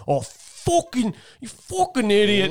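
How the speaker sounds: put-on slow, drawn-out cursing in an Irish accent; thick Irish accent. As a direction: imitate an angry Irish golfer without raising the volume. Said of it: "he's got that thick Irish accent".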